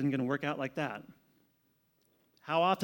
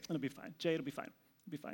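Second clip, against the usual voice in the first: calm reassurance; 11 dB quieter, faster than usual. Retold or imitated. Jaime calmly reassuring the worried pastor, soft and easy. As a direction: soothe a worried friend softly. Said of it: "And he's like".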